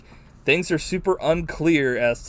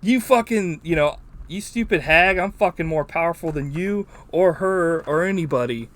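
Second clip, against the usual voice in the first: mock rant; 4 semitones higher, 4 dB louder than usual. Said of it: "He was like".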